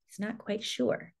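plain reading voice

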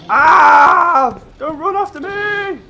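high, slow, panicked cry; terrified scream. Real, not acted. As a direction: scream as you flee. Chased by a monster.

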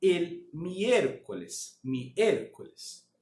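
slow, clear teacher model of miércoles. Shown as a demonstration. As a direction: spell miércoles out slowly for students to repeat. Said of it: "Repete comigo".

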